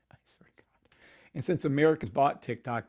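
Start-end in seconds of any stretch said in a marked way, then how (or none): 0.1-0.9 whispering. Real, not acted. Aside after laughing.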